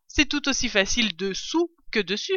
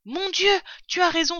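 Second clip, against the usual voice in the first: high-pitched cry of realisation; surprised exclamation; 10 semitones higher than usual. Acted. Father fox realising his cub is right, surprised cry. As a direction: exclaim as it suddenly dawns on you. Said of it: "s'écria Maître Renard".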